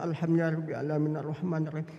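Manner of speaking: low, run-together prayer; mock someone reciting on autopilot; hurried memorised delivery; rote recitation. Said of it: "Karena hafal, jalan dia".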